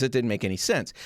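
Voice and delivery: ordinary talk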